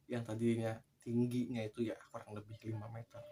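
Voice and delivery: ordinary delivery, nothing marked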